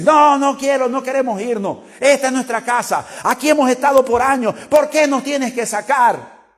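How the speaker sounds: whiny demons refusing to leave, building to shouted fuera; mock demon protest rising to a shout. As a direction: play the stubborn demons who won't go, then yell them out. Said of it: "Normalmente dicen".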